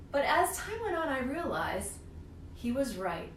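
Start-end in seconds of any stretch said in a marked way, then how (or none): none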